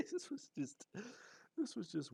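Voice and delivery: high, squeaky laugh through the words. Real, not acted. Recalling the crazy trip, breaking into real laughter. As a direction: crack up while trying to speak.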